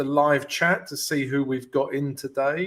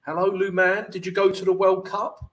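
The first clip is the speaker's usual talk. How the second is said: usual speaking voice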